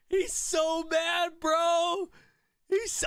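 high, slow teasing gloat; mocking. Real, not acted. Gamer gloats over the furious opponent, teasing. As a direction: drag it out to rub it in.

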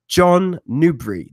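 a mock English accent on the name; a playful English accent, stretched out. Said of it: "see if I can do my English accent".

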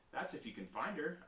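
usual speaking voice

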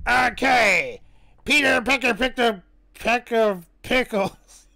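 forceful put-on villain voice; labored tongue twister in a cartoon character voice; Wario. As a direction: punch out each word in character. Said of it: "we got the Wario command".

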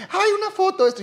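high squealing fan voice; gushing fan. Retold or imitated. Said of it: "yo soy de los que". (fan emocionado chillón, mucho más agudo)

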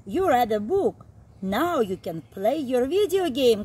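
ordinary delivery

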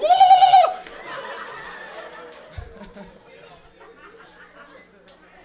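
loud high penguin squawk; Pingu squawk. Retold or imitated. Pingu getting mad, loud high squawk. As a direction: squawk like an angry cartoon penguin.

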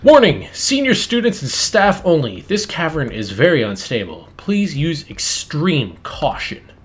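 loud, slow warning announcement; announcer voice. Acted. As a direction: read it like a loudspeaker warning. Reads a sign aloud.

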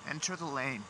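mock-polite call to a driver; sarcastic. Real, not acted.

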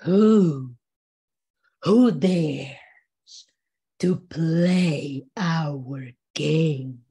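low, very slow, mysterious deity voice; menacing underworld lord. Shown as a demonstration. Storyteller voices the lords of Xibalba, menacing and mysterious. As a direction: intone it low from underground, stretching every word. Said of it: "it has to be a little menacing".

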